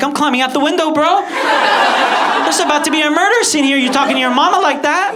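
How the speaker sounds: high, loud panic; alarmed. Acted. Friend fleeing as boy yells at his mother, alarmed. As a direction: raise your voice in alarm and get out.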